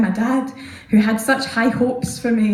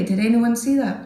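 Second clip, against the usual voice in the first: ordinary talk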